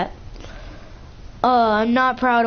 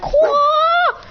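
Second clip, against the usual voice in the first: shrill high mock shriek, much louder; high squeaky battle cry, hammed-up; squirrel-like scream; 5 dB louder than usual. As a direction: let out a squealing karate yell. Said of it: "She's screaming like a dead squirrel".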